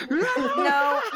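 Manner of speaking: high, loud laughing refusal; laughing protest. Real, not acted. Game master refusing a player's silly idea, laughing. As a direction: burst out laughing on the word.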